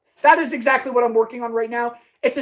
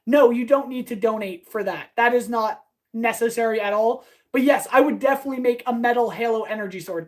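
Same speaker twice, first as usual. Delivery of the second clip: ordinary delivery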